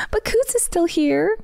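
high, bright talking-to-pets voice; animal voice. Real, not acted. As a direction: talk to the cat like a pet. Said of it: "You just said that just like her".

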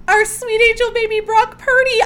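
high, loud gushing squeal; squealing delight. Real, not acted. Host gushing to listeners about their shared player, squealing with joy. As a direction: gush over him like a proud parent.

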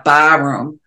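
Bostonian vowels with the r dropped; Boston accent. Real, not acted. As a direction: let the Boston accent slip out. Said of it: "I said it like a Bostonian".

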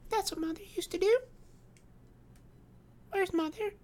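put-on very high, small child's voice; innocent, piping little-girl questioning. A narrator's reading. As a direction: pipe up as a trusting five-year-old.

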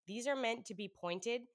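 ordinary delivery

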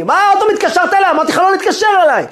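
high-pitched exasperated yell; furious outburst. Retold or imitated. Rabbi berating the repeat caller, furious outburst. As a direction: explode at the persistent caller, voice shooting up.

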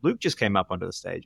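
ordinary talk, nothing marked

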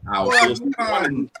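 loud playful threat, half-laughing; mock threat. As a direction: shout the threat jokingly. Joking threat to Kira.

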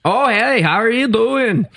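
lightly hammed-up Mennonite accent greeting a visitor. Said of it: "The Mennonite accent's just really annoying".

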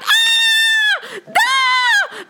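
high, loud shriek of alarm; sudden high-pitched scream; panicked shout. Acted. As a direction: shriek as if monsters just appeared. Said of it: "there was a shout".

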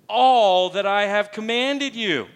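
"all" drawn out high and loud; stress on ALL. Shown as a demonstration. Preacher reading the verse to the church, stress on "all". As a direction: hit the word "all" hard and stretch it. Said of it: "kinda put some inflection on".

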